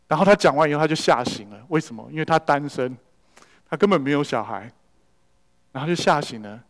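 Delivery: ordinary talk, nothing marked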